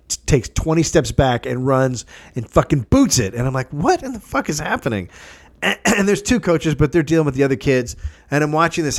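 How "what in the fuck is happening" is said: retold disbelief, pitched up; higher, mock-baffled outburst; incredulous. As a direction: play your own baffled reaction. Acting own reaction to booted ball.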